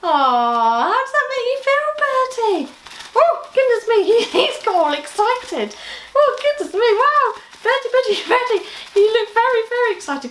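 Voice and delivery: high, loud, giggly excitement; excited squeals. Acted. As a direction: bubble over with joy for the puppet. Puppet Bertie gets excited.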